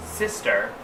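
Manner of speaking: plain reading voice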